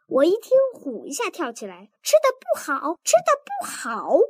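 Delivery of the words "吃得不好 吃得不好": 尖着嗓子，又高又急地反问; 像被踩到尾巴一样拔高嗓门; 气急重复. Acted. 妻子气得跳起来重复. (妻子被嫌饭菜不好，气得尖声重复)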